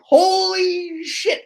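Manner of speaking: loud, high, drawn-out exclamation; retold shock, long-held first word; stunned realisation. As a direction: stretch it out in high-pitched amazement. Retells own shocked realisation.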